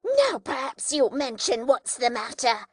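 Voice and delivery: breathless furious sister; breathless fury. A narrator's reading. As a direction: scold him while panting from the struggle. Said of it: "out of breath".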